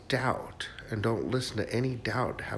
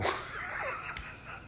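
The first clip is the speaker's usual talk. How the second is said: high airy giggle; 10 dB quieter than usual. Real, not acted. Laughs at own talk.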